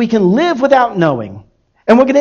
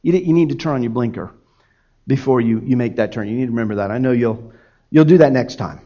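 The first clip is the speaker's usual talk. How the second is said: gentle correction; 8 semitones lower, 3 dB quieter, faster than usual. Retold or imitated. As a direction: lower and softer, gentle coaching. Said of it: "I'm gonna correct him".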